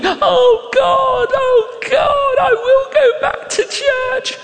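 high-pitched wailing cry to God; hammed-up tearful wail; mock weeping. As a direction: imitate a man sobbing out a prayer, very high. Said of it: "he starts crying. He's weeping. And he's going".

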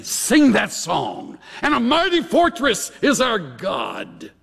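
high-pitched mocking demands; jeering captor. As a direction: taunt the prisoners into singing hymns. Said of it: "One of our captors said".

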